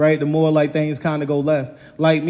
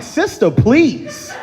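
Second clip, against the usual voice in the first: exasperated scolding; 10 semitones higher, much slower than usual. Retold or imitated. Dad scolds daughter about wolf.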